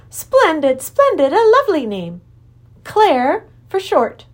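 high, loud, joyful creature king; delighted Wangdoodle king. Acted. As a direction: relish the name with delight.

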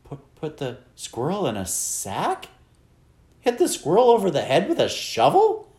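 stammering disbelief climbing high and loud; appalled, rising squeaky voice; squeaky, despairing. A narrator's reading. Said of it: "said Flora's father in a squeaky, despairing voice".

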